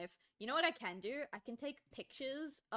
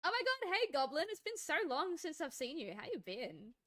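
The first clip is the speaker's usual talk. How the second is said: excited greeting; 6 semitones higher than usual. Real, not acted. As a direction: greet them like a long-lost pal.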